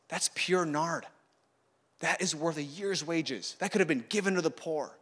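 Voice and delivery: soft, indignant murmur; disgruntled mumbling. Acted. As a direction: complain quietly among yourselves. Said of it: "they're mumbling".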